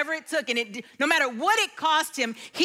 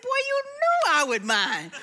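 high-pitched mock outrage; indignant scolding; 11 semitones higher than usual. Sister tells off brother who let it happen, indignant. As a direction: scold him in a raised pitch.

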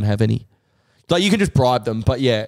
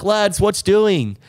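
much higher, very slow, falsely friendly hello; breezy thief greeting; 8 semitones higher, much slower than usual. Retold or imitated. The thief greeting the lads he robbed, high and slow. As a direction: drawl it like nothing ever happened. Teller voicing the thief at beach.

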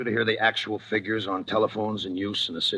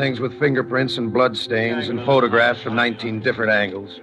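flat world-weary voice-over; noir narration; 5 semitones higher than usual. A narrator's reading. Detective telling the listener about the wait, dry voice-over. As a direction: report the wait like routine.

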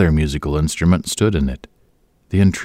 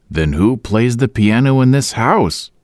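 higher, louder demand; roaring; 6 semitones higher, 4 dB louder than usual. A narrator's reading. Boyce roaring at the old woman. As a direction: roar the question. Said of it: "roared Boyce".

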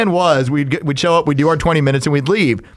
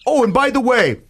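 Italian guy prank caller; 7 semitones higher, slower than usual. Retold or imitated. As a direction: shout it like a brash Italian caller. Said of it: "I call as the first person".

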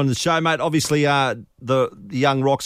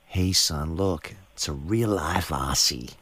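low, slow imitated American drawl; American accent; 9 semitones lower, 6 dB quieter, much slower than usual. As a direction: put on a low American accent, pointing out a curiosity. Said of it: "these two Americans behind us".

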